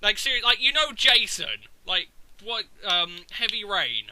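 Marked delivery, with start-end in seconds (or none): none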